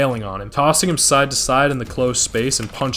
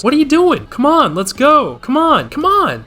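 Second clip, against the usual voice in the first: taunting; 13 semitones higher, 5 dB louder than usual. Retold or imitated. Penguin taunting Sal, high and mocking. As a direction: egg him on with a sing-song sneer. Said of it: "I know he's taunting".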